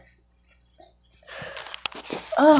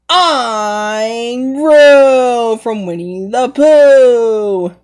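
ordinary talk

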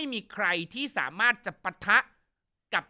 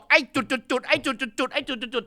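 lightly voiced bleeped curses, higher; censored mock swearing; 4 semitones higher than usual. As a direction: chant the censored curses in a mild mock scold. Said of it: "แม่ก็บอก". (ด่าแบบเซ็นเซอร์ เสียงสูงขึ้นเล็กน้อย)

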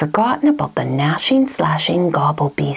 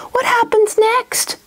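high, slow plaintive question; dismayed boy; slower than usual. Acted. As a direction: plead to hear the rest. Boy dismayed at missing page.

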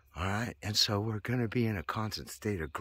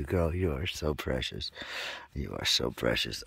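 tender pet talk; 6 semitones lower than usual. Real, not acted.

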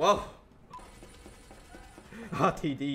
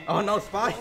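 shouted higher and louder; calling out; 9 semitones higher, 4 dB louder than usual. Real, not acted. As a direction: warn your team with a yell. Calling out spy alarm.

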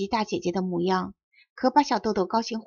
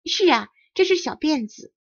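higher and a bit louder, pleased with herself; proudly; 6 semitones higher, 3 dB louder than usual. A narrator's reading. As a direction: answer with a proud little lift. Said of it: "得意地说". (得意地说)

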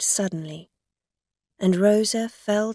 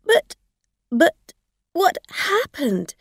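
shaken; 10 semitones higher, 6 dB louder than usual. Acted. Shaken driver stammering.

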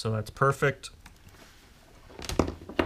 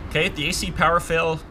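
higher and louder, pushing over machine noise; raised voice; 7 semitones higher, 7 dB louder than usual. Real, not acted. Technician raising his voice over the running motor, loud. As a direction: speak up over the hum of the running blower.

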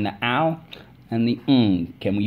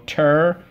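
TER-IF-IC in three loud, separated chunks; syllable by syllable; 7 semitones higher, 9 dB louder than usual. Shown as a demonstration. Teacher leading spelling class, syllable by syllable. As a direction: chant Ter-if-ic loudly with long gaps, f closing the middle syllable. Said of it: "Let's go".

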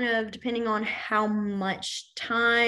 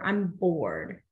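lower, listless bored demo; mock bored, pitched down; acted boredom; 6 semitones lower than usual. Shown as a demonstration. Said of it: "the sign for bored is bored".

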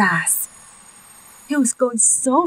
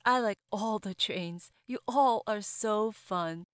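soft judge; 2 semitones lower, 14 dB quieter than usual. A narrator's reading. Judge announces favorite.

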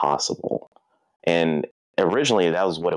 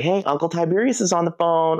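sister calling out; 12 semitones higher than usual. Retold or imitated. Sister calling toddler's attention.

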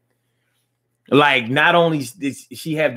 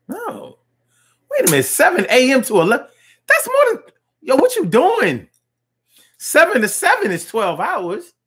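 high, fast, incredulous; can't believe what you're hearing; stunned disbelief; 10 semitones higher than usual. Real, not acted. Host stunned by caller's twelve-hour shifts, high disbelief.

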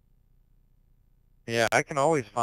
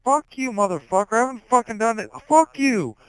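much higher, much louder yell; 13 semitones higher, 12 dB louder than usual. Real, not acted. Streamer screaming at Hulk, furious. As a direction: scream it at him. Yelling back at friend Hulk.

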